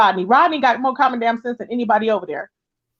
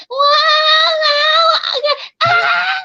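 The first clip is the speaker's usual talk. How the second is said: mocking high-pitched wail, stretched out; high-voiced wailing impression; 13 semitones higher, much slower than usual. Woman mocking how his voice used to sound, high exaggerated wailing. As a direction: wail it very high, mimicking a bass-less voice. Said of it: "he had put some bass in his voice. But before it's like".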